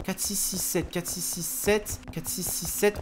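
soft, low-key repetition of digits; hushed reciting. Real, not acted. Gamer memorising the code as the monster nears, hushed reciting. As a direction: recite it softly to yourself to memorise.

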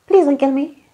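high, pleading little voice; quirky little voice. Retold or imitated. Imitating the dying tyrant begging, high and quirky. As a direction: beg in a weird small voice. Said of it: "in the weirdest, quirkiest little voice".

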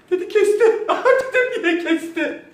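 high giggling through the words; cracking up, barely able to speak; laughing. Real, not acted. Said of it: "toparladım". (kahkahalar arasında, yüksek sesle)

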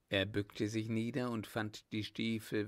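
usual speaking voice

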